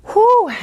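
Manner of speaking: a high, loud breath of relief after effort; relieved whew. Real, not acted. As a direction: blow it out like you just finished the set. Exhale after hard plank set.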